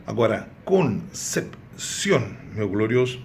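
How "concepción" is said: "concepción" slowly broken into con, cep, ción; deliberate teacher's split of "concepción"; syllable by syllable. Shown as a demonstration. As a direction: separate the three syllables of "concepción". Teacher splits the word again.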